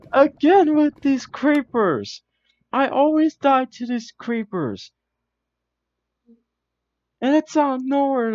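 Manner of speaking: loud, high exasperated rant; frustrated shout. Real, not acted. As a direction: yell it in frustration. Blown up by creepers again.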